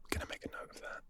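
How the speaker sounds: hushed note to self; whispered aside. Real, not acted. Muttering a note to himself in a whisper.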